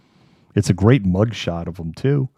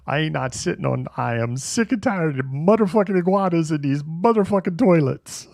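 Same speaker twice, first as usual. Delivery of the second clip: Sam Jackson; 9 semitones higher than usual. Retold or imitated. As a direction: rant like an angry action hero. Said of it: "changing my living person to Sam Jackson".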